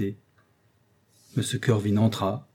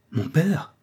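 high, rising astonished question; surprised cry; 6 semitones higher than usual. A narrator's reading. Prisoner hearing his father has come, surprised cry. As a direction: catch your breath in surprise. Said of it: "m'écriai-je".

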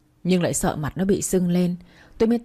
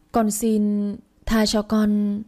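weak pleading; 3 semitones higher, much slower than usual. A narrator's reading. Frightened child begs for mercy.

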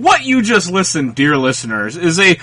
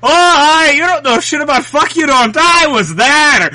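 mock Irish accent, higher, bragging rant; bad Irish accent; 8 semitones higher than usual. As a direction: rant back at your friend in a piss-poor Irish accent. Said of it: "extravagant tales in an Irish accent".

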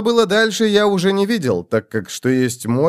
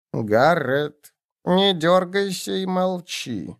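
tense hushed goblin warning; 5 semitones higher, 3 dB quieter, much slower than usual. A narrator's reading. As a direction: whisper it like a wary goblin. Goblin warns in tense hush.

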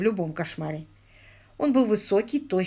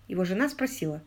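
usual speaking voice

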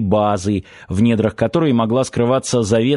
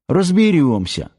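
puffing out the word; huffing with effort; 5 semitones higher than usual. A narrator's reading. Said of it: "пропыхтел толстяк".

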